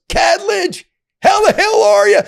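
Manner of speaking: booming, high-pitched hearty shout across the hallway; imitating a boss yelling hello; retold loud greeting. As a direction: shout the greeting like an old friend. Warden's boss greets him loudly.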